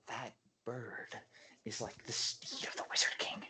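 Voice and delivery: whispered aside to a companion; urgent whisper. Acted. Player jabs Eric and tells him about the bird, whispering. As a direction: whisper it insistently, without voice.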